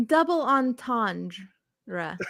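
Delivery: very slow "Double entendre" ending in "ruh"; showing pronunciation. Shown as a demonstration. Demonstrates her way, laughing at it.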